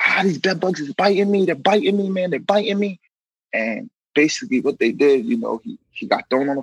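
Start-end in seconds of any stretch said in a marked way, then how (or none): 0.1-3.0 higher, whiny complaining inmate. Retold or imitated. Inmate whining to officers about bed bug bites, higher.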